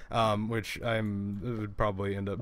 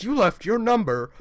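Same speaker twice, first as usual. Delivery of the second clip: louder mock accusing caller; imitated angry caller; 5 semitones higher, 5 dB louder than usual. Retelling the caller's accusation about a note, imitating his angry voice. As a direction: lightly act out an irate man on the phone. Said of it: "trying to reenact the gentleman's voice".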